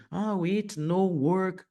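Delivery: slow, mock learner saying it no work; mock beginner's broken English, said slowly; wrong learner English. Shown as a demonstration. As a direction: play the beginner who gets it wrong, said slowly. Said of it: "a gente quer colocar um no".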